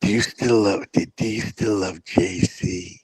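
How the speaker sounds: low, slow mock-solemn question; imitating a ponderous question, low and soft; mimicking the questioner. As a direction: mimic him, slow and drawn out. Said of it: "why do you say it like that".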